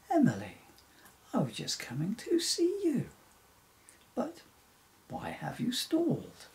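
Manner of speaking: high, projected friendly call. Acted. Bill the unicorn calls to Emily from behind her, calling out. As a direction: call after a friend, pitch leaping up. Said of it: "a voice behind her called".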